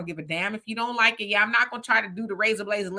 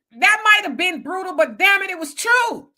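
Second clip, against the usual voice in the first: ordinary delivery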